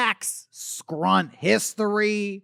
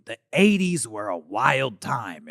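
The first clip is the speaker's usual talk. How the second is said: ordinary talk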